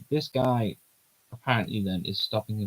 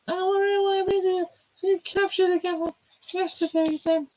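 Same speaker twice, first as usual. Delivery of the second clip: put-on bright girlish character voice, much higher; high heroine voice; 3 dB louder than usual. Voicing the heroine asking where the trip goes, high girlish voice. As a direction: play the young heroine, light and high.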